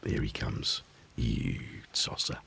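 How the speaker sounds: soft, low teasing insults; mock insult. To the returning cat, soft low mock insults. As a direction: lovingly mutter insults at him.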